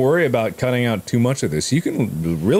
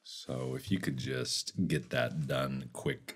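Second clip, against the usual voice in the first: low, very soft, slow; softly slipping in a favour; quiet request; 10 semitones lower, 16 dB quieter, slower than usual. Pretend. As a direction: drop right down into a slow, hushed ask. Quiet aside to editor.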